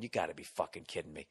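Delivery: low muttered disbelief. Retold or imitated. Alone at the rental car at dawn, low disbelieving mutter.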